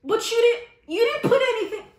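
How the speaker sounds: high, loud laughing protest; incredulous protest. Retold or imitated. Teller protesting to the stylist, high and laughing. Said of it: "I'm like".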